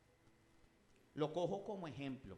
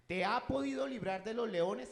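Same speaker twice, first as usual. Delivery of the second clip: higher, louder anxious cry; desperate question; 7 semitones higher, 9 dB louder than usual. A narrator's reading. Preacher voicing the king's desperate cry at the lions' den. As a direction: plead it like a king afraid for Daniel. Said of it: "Era una pregunta desesperada".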